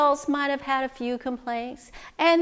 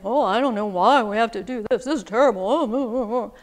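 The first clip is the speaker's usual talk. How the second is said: acted grumbling, running into wobbly moans; complaining; 2 semitones lower, faster than usual. Storyteller voicing adults in the crowded hut, complaining. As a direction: moan and grumble like fed-up grown-ups. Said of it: "They were complaining".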